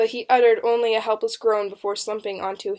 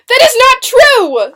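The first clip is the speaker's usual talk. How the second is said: very high, loud, drawn-out shriek; 16 dB louder, slower than usual. A narrator's reading. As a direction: shriek the denial at full voice. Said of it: "Susanna shrieked".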